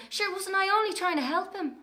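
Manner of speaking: high innocent protest; mock innocence. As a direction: play the wronged angel to Mam. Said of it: "And I'd say to Mam".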